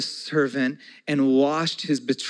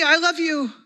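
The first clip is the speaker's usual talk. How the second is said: put-on much higher, louder childlike gush; gushing child; 13 semitones higher, 6 dB louder than usual. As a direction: squeal it like a little kid. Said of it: "It's like, all me".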